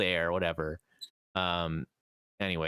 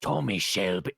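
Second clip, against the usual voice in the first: lower, faltering British accent attempt; Tommy Shelby; 5 semitones lower than usual. Shown as a demonstration. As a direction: grope for a deep British gangster accent. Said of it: "I lost it. It's gone".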